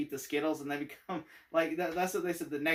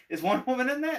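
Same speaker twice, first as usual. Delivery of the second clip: higher, louder hopeful ask; eager Shazam; 9 semitones higher, 6 dB louder, faster than usual. Retold or imitated. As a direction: ask it like a starstruck kid. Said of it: "He goes".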